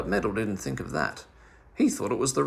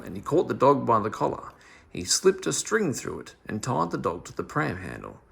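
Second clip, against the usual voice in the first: usual speaking voice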